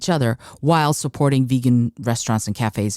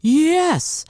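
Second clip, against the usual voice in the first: high, eager "Yes."; a thrilled ideal client saying "Yes"; delighted yes; 14 semitones higher than usual. Retold or imitated. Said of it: "look at what you're doing and go".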